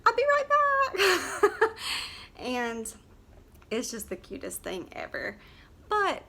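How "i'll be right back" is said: high, sing-song Southern sweetness, laughing. Retold or imitated. Vlogger mimicking a country singer's sweet aside, high and laughing. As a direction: coo it like a sweet country girl. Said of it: "and she was like".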